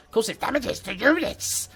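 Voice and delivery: high, slow ratty creature voice; Rat Man voice. Retold or imitated. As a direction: squeak it out slowly like a rat man. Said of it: "That's my best Rat Man impression".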